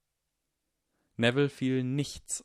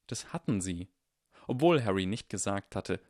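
usual speaking voice

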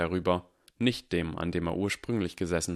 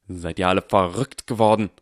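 much louder barked question; barking; 8 dB louder than usual. A narrator's reading. Snape barks at the panicking class. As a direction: bark at the whole class. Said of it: "blaffte Severus' Stimme".

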